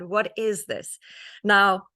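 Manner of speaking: normal talking voice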